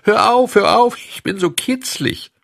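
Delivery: very high, loud squeal of a ticklish little wood voice; a high, shrill little voice from inside the log, squealing to stop; tiny giggling voice. Acted. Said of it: "kichert's und lacht's".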